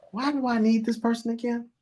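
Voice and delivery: high, scoffing question; dismissive partner. Retold or imitated. Mocking a selfish team member, high scoffing. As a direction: brush the person off. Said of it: "Y'all like".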